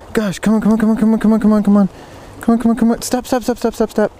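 high, rapid-fire repeated pleas; urgent pleading. Real, not acted. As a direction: urge a wriggling fish in a fast high chant. Urging fish to keep still.

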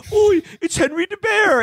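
high, sing-song kiddie bear voice; cutesy teddy bear voice. Retold or imitated. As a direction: squeak it like a children's mascot. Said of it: "where they were like".

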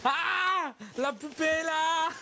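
very high delighted shriek with laughter; shrieking jackpot winner. Real, not acted. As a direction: squeal like a lottery winner. Shrieks over a doll.

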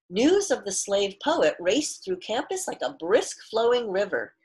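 clear, fuller read-aloud storytelling voice; story narration. A narrator's reading. Teacher reads picture book aloud.

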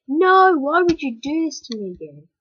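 loud, high exasperated protest; loud whining complaint at the game; frustrated outcry. Real, not acted. Game character keeps dying.

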